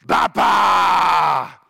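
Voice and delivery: little granddaughter's loud, high growling shout; growling little girl. Retold or imitated. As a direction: roar it out like an excited little girl. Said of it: "she gets this growl in her voice".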